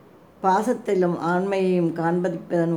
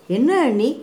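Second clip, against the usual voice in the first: higher, louder startled question; surprised; 5 semitones higher, 5 dB louder than usual. A narrator's reading. Young woman greets her unexpected sister-in-law, surprised. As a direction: ask in open surprise. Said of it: "Kothai ஆச்சரியமடைந்தாள்".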